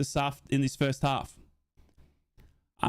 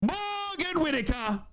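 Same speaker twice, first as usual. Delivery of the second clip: drawn-out lament; 13 semitones higher, much slower than usual. Real, not acted. Lamenting the missed chance.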